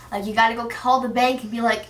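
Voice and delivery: ordinary talk, nothing marked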